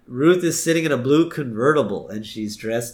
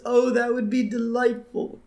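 much higher, put-on prissy voice; Homer's dainty voice; 12 semitones higher than usual. Homer accepting a makeover, high and prissy. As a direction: go high and delicate, mock-delighted. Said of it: "And Homer says".

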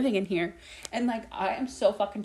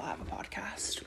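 breathy whispered joke; 13 dB quieter than usual. Real, not acted. Podcaster whispering in mock disbelief at herself. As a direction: whisper it at yourself.

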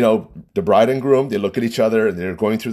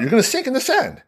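exasperated insistence; 12 semitones higher, faster than usual. Retold or imitated. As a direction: insist as if retelling an argument. Retold argument, insisting she will sink.

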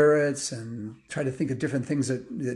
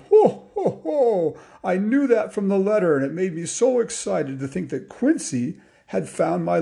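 loud high ho-ho, then hearty speech; jolly old Santa; 7 semitones higher, 5 dB louder than usual. Acted. Santa tells Quincy he knew her name, jolly and excited. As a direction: chuckle big and merry as Santa. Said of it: "jolly old Santa".

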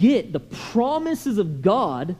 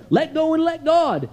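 higher-pitched, emphatic mock slogan; recited slogan, pitched up and pressed; mimicked pat advice; 6 semitones higher than usual. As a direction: parrot the advice people always give, punchily. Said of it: "That's what people tell me".

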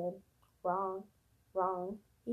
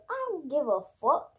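loud movie quote; 4 semitones higher, 6 dB louder than usual. Retold or imitated. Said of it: "his cousin, he goes".

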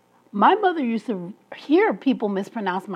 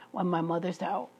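normal talking voice

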